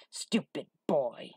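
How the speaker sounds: slow, gruff hunchback scolding; menacing, drawn-out rebuke; angry Igor cry. A narrator's reading. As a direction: drag out the insult in a rough voice. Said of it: "he cried, shaking his bear".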